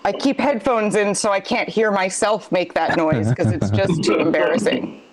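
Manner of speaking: usual speaking voice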